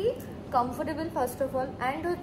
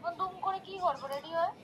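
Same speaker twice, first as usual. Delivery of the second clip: soft, higher-pitched phone voice; voice over phone; 4 semitones higher, 6 dB quieter than usual. Real, not acted. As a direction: answer back faintly down a phone line. Friend answering on a phone call.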